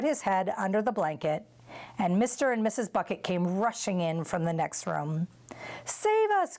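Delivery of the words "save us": put-on high, panicked old woman's cry; frightened cry. A narrator's reading. Grandma Josephine cries out in fright as the roof falls, high. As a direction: shriek for rescue as the roof caves in. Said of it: "cried Grandma Josephine".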